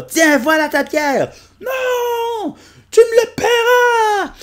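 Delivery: high, loud yelling with a laugh; cartoon shouting. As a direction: yell in a squeaky cartoon voice. Dispute entre personnages. (cris aigus de dessin animé)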